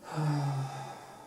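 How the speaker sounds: long tired sigh; weary sigh. Real, not acted. Tired of slow work.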